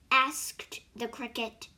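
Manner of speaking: usual speaking voice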